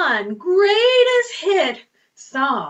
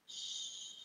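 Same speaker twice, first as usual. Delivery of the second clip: shushing; 24 dB quieter than usual. Shown as a demonstration. Hushing pretend sleep.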